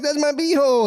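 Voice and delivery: high squeal; high-pitched protest; shocked. Retold or imitated. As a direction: squeal in alarm. Acted startle.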